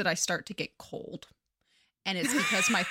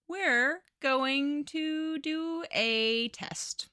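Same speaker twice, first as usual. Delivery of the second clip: slow, higher haughty duchess; slow, raised mock-regal tone; 5 semitones higher, slower than usual. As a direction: drawl it out like a scheming duchess. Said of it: "the Duchess is like".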